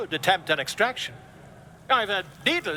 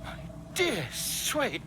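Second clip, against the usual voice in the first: slow, high-pitched astonishment; aghast, with pauses between the words; shocked exclamation; 7 semitones higher, much slower than usual. Acted. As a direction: draw out each word in theatrical shock. Shocked by sight inside the eye.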